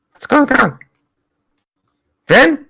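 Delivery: mild alarm, high and softish; worried in-character calling. Acted. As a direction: call out uncertainly for a missing friend.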